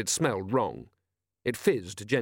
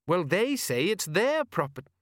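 higher voice of a reasoning son; contradicting son; 7 semitones higher than usual. A narrator's reading. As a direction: point it out to Dad, pitched up.

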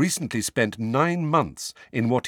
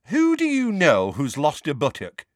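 interested; 6 semitones higher, 4 dB louder than usual. Acted. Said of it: "asked Mad-Eye interestedly".

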